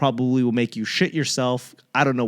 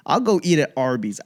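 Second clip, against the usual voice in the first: ordinary delivery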